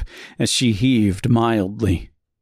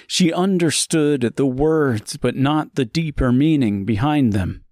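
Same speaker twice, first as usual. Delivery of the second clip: ordinary delivery, nothing marked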